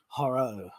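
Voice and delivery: "Horror" said low as "horro", ending in an oh; mispronounced horror. Real, not acted. Streamer fumbling the word horror, mispronounced as "horro". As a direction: say "Horror" with a round O at the end. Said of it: "sounded like I was doing a really bad Chinese accent".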